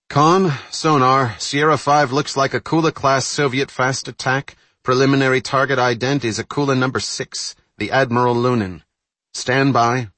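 rattly intercom voice; through a blown speaker. Acted. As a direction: sonar report over a speaker. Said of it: "The bulkhead-mounted speaker rattled".

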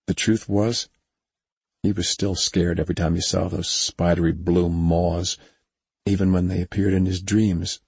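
ordinary delivery

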